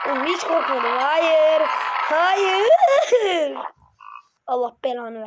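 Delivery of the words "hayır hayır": loud "Hayır" climbing high on the second; frightened shout. Real, not acted. As the alien comes closer, a loud frightened no. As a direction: cry out no in fright.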